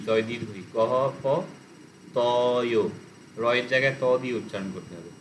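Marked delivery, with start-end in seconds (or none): 0.8-2.8 "গহ প", pause, then "তয়"; split syllables. Shown as a demonstration. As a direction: break "তয়" off as its own piece. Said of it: "split করে করে বলতে পারো". ("গহ প ... তয়" ভেঙে ভেঙে)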